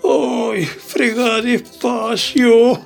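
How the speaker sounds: slow, half-laughing whimper; pained whimper. Acted. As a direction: moan it through the ache. Pained during massage.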